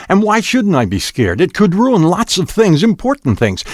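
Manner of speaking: high-pitched, agitated argument; scared outburst. A narrator's reading. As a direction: argue upset, drawing out the last words. Frightened lover quarrels in the story.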